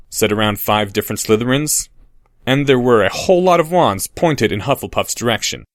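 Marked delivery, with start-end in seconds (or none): none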